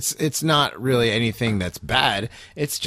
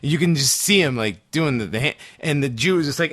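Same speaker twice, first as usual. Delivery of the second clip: ordinary talk, nothing marked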